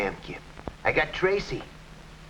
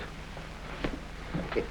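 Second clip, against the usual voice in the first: breathy gloating chuckle; 10 dB quieter than usual. Acted. Flat Top gloats over gagged Tracy.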